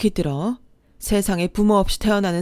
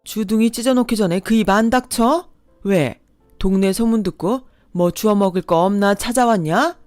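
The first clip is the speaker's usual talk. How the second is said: loud, high furious tirade; furious scolding; 4 semitones higher, 4 dB louder than usual. Acted. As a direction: tear into him without stopping. Mother berates the ex.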